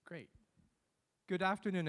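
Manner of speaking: ordinary talk, nothing marked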